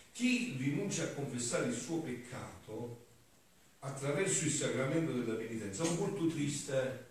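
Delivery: forceful preaching. Acted. Priest to the congregation, preaching with force. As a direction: preach it out to the whole church.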